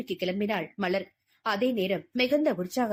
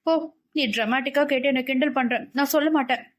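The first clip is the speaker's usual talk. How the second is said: put-on higher-pitched, pouting girl voice; sulky refusal; 4 semitones higher than usual. Malar refusing to tell her scolding friend, sulky. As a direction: pout and refuse like a hurt friend. Malar sulks at his teasing coaxing.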